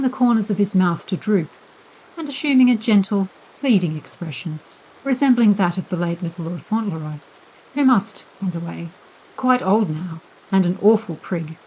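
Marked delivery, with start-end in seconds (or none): none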